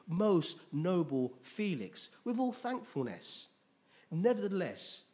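scripture reading. Acted. Preacher reads Tertullus's accusation to the congregation, declaiming. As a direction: read it out formally, with measured, emphatic phrasing.

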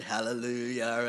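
put-on a quick hallelujah in a light American accent. Preacher mimicking an American church cheer, light American accent. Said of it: "I did that in kinda like an American accent".

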